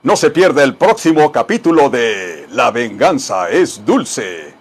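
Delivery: a hint of TV trailer announcer; mock promo voice. Teacher jokingly announcing the next telenovela episode.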